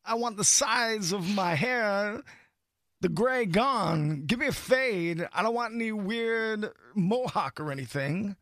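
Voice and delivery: halting, slightly high celebrity impression; slow, oddly spaced phrases with stretched key words; Christopher Walken. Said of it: "So that's Christopher Walken getting".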